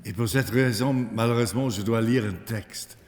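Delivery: English speaker's French accent; careful French with an English accent; foreign accent in french. Real, not acted. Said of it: "mon français est devenu très faible".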